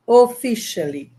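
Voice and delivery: ordinary talk, nothing marked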